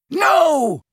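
loud, high, long roar. A narrator's reading. Snape stopping Harry's torture, roared. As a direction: roar it to halt the attack. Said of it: "roared Snape's voice".

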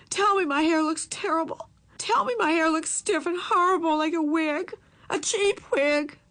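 high, tearful outburst; angry and tearful. A narrator's reading. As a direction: fling the words out in tears. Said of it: "she burst out in anger and in tears".

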